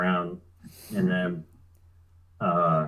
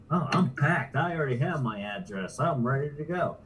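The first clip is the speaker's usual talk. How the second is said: mock boast; 6 semitones higher than usual. Retelling his mocking boast.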